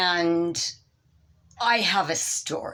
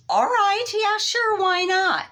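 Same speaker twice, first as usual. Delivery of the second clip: squeaky high, forced okay; high reluctant agreement; 12 semitones higher than usual. Retold or imitated. Wife forcing a high agreement to her husband.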